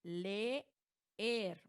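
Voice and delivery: LEER split slowly as le, er; separate syllables. Shown as a demonstration. Teacher answers a student's question, leer split into two syllables. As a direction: say "leer" in two beats, a gap between the e's. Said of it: "¿como leer es hiato?".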